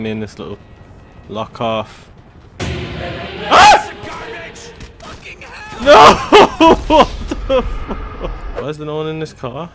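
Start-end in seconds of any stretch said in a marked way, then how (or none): 2.6-8.3 very high loud scream turning to laughter; scream then laugh. Real, not acted. Player jump-scared in a car, high loud scream into laughter. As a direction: shriek in fright then crack up.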